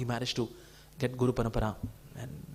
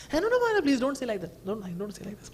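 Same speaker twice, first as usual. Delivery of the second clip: eager protest; 6 semitones higher, faster than usual. Retold or imitated. Priest protests the guru's apology.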